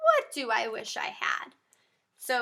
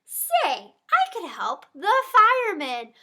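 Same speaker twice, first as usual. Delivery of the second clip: ordinary delivery, nothing marked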